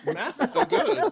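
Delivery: high, loud, slow caveman talk through laughter; dumb caveman voice. Acted. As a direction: play dumb, laughing at yourself. Joking after a math mistake.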